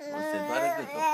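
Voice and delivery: high squeaky coo. Real, not acted. Father cooing to hoarse baby.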